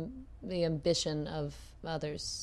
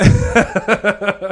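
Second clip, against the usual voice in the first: loud burst of laughter; 2 semitones higher, 15 dB louder than usual. Real, not acted. Texan guy laughing at his own accent, loud laugh. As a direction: laugh big at your own joke.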